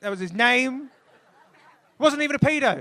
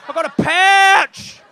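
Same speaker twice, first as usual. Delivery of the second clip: a much higher, slow, loud Australian yell; shouted mock Australian accent; 10 semitones higher, 14 dB louder, much slower than usual. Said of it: "that's all you sound like".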